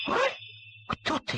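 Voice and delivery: frightened, breathy half-whisper; startled duckling asking in fear; hushed frightened question; scared whisper. A narrator's reading. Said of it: "испугался Тим".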